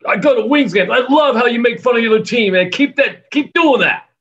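loud, high-pitched gushing; excited fan impression. Team owner praises the announcer's mockery, in excited shouts. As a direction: shout it with hammed-up enthusiasm.

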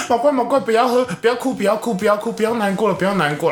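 high, lightly voiced soothing friend; a hint of a friend pleading don't cry; comforting friend. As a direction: coax her to stop crying. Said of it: "他就说".

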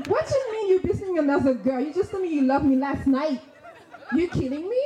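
high, hammed-up indignation with laughter; outraged disbelief. Girlfriend confronting boyfriend about another girl, mock outrage.

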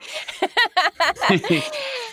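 high delighted laugh. Real, not acted. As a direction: burst out laughing. Laughing at joke.

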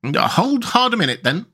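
high-pitched character voice, checking someone; Rat. Acted. Rat telling Mole to wait, high voice. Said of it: "said the rat".

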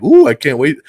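Ooh, I can't wait squealed eagerly; excited. Retold or imitated. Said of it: "that's like".

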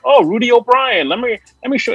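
high, lightly imitated Irish voice; mock Irish accent. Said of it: "the Irish voice".

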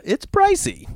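jumps way up high in disbelief; pricey squeal. Real, not acted. Telling the audience, squeaked in disbelief.

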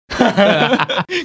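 bright rising burst of laughter; high rising giggle. Real, not acted. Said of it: "笑死我！".

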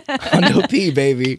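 Hundo P stretched out slowly through a laugh. Real, not acted. Cohost repeating the catchphrase, drawn out and laughing. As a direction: savour the words Hundo P while laughing. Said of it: "Work that in there".

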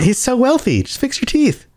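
high, exasperated disbelief. Real, not acted. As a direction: throw your hands up at him. Exasperated at Tom Cruise's teeth.

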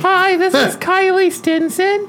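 high, slow fake girl voice; high sweet girl on the phone; girly voice. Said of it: "You should call him".

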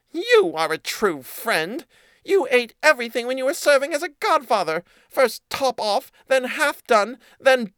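squeaky mouse listing the cat's lies; accusing mouse voice. A narrator's reading.

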